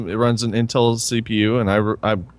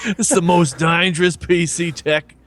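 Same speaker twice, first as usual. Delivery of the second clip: mock Australian accent, raised pitch; Crocodile Hunter; 5 semitones higher than usual. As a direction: announce it like an Aussie wildlife host. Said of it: "the Crocodile Hunter told me off".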